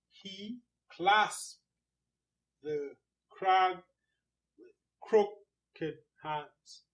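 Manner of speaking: very slow, "clasped", "crag", "crooked", "hands" stressed between long pauses; metrical stress. Shown as a demonstration. Teacher marking ticks on the line, slow stress on "clasped", "crag", "crooked", "hands". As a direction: punch "clasped", "crag", "crooked", "hands" and drop the small words. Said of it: "no stress here, stress there".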